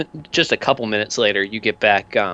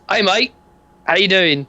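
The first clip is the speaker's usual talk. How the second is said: chummy, higher geezer greeting; dodgy dealer; 8 semitones higher than usual. Acted. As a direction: greet him like a shady pal. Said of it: "and goes".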